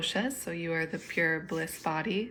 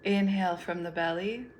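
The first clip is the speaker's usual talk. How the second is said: guided meditation. Acted. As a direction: lead them gently through each breath, unhurried.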